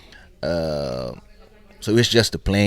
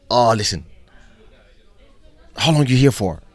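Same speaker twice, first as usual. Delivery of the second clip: raised, loud pitch to a star; imitated eager promoter; 5 semitones higher, 8 dB louder than usual. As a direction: press him loudly and eagerly.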